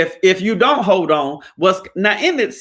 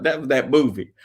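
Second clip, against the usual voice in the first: ordinary delivery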